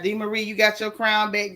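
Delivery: ordinary delivery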